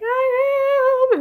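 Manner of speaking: imitated high, loud tearful wail; crying. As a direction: wail it out as a mock happy sob. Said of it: "And I'm, like, crying".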